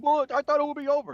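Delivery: high, squeaky mocking imitation of mom. Son mocking his mom's excuse, high squeaky voice. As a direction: parody her whiny protest up high.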